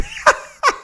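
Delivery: high burst of laughter mid-question. Real, not acted. Host cracks up mid-question to guest, high laugh. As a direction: crack up helplessly.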